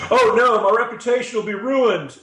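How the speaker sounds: loud, high-pitched mock dismay; bad Bill Clinton. Commentator mocking a blackmailed Bill Clinton, badly faked high panic. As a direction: cry out in hammed-up alarm, badly imitating a politician. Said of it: "really bad Bill Clinton impression".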